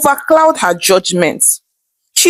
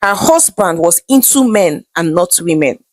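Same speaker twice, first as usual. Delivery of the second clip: usual speaking voice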